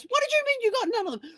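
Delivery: husband driving, furious and disbelieving, high yell; outraged husband. Retold or imitated. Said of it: "goes on this complete tirade".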